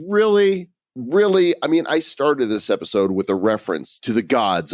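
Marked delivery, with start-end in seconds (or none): 0.1-1.5 high, loud disbelieving really; can't believe you went there; exasperated double really, pitched up; incredulous. Real, not acted. Incredulous at [name] reference.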